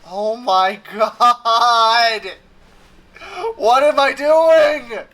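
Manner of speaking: loud, drawn-out groan of disbelief; high, loud, stretched-out protest; exasperated outburst. Real, not acted. Frustrated by pointless clicking task.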